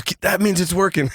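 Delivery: high, laughing mock urging; eager insistence. Dad tells sick brother to continue, eager insistence.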